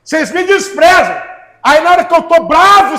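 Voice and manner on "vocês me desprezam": ordinary talk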